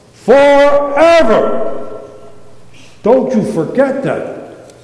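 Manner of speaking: Forever very slow, high and loud; climactic preached shout. Shown as a demonstration. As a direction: give Forever everything on the last take. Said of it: "One more time".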